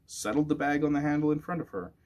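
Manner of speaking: ordinary delivery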